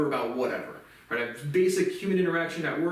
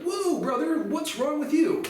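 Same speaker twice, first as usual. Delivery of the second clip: much higher mock bro voice; mock reply; 12 semitones higher, slower than usual. Answering strangers online in a high mocking bro voice. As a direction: throw their question back in a high bro voice. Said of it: "I'm like".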